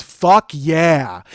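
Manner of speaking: loud, thrilled "fuck yeah"; agency guy cheering from the couch; hyped cheer. Retold or imitated. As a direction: yell it like a fan at a fight. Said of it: "agency guy goes".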